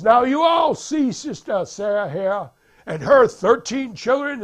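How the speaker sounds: high, loud preacher addressing the flock; prédicateur. Retold or imitated. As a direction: rouse the congregation from the pulpit. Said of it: "the preacher says".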